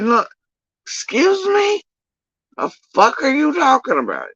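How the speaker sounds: very high, affronted questioning; incredulous retort. Acted. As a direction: throw their excuse back in disbelief. Acted retort to the accusers' excuse.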